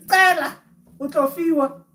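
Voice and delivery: high, loud declaration; emphatic proclamation. Real, not acted. Teacher proclaiming the ask-and-receive saying, loud and high. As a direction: announce the saying like a rallying call.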